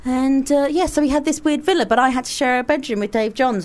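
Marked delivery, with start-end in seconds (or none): none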